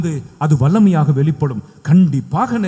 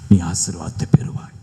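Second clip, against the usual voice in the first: whisper; 12 semitones lower than usual. Real, not acted. Blessing spoken in whisper.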